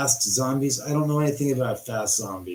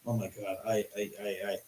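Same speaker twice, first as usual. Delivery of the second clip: starstruck stammer; 4 semitones lower, 12 dB quieter, faster than usual. Retold or imitated. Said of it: "smitten with him, going".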